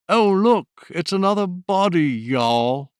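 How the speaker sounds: a Northumberland accent with a South Texas twang, pitched high and loud. Shown as a demonstration. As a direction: Northumberland accent with Texas vowels, playful, for a murder mystery. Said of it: "a Northumberland accent with a South Texas twang".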